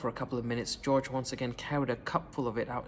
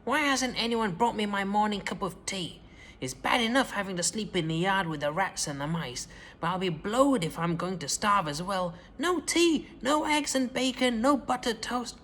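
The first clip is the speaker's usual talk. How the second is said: furious shout; 6 semitones higher than usual. A narrator's reading. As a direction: bellow down from a great height. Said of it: "she shouted".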